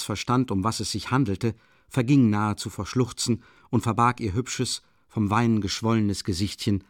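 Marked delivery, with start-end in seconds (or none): none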